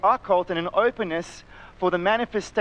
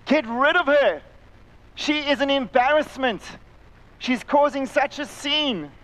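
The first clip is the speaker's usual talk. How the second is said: raised, exasperated voice pitched higher; irritated disciples; 7 semitones higher, 3 dB louder than usual. Retold or imitated. Disciples urging Jesus to send the woman away, exasperated. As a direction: complain impatiently to get her sent away. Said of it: "disciples are begging Jesus to say".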